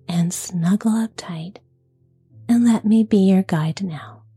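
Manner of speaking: soft, gentle whisper; soft bedside voice. Real, not acted. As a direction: whisper it gently, like tucking someone in. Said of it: "with a nice, soft, gentle voice".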